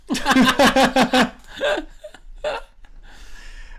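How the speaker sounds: a long high burst of real laughter. Real, not acted. Laughing at the twelve dollar story, real high laughter. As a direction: crack up out loud.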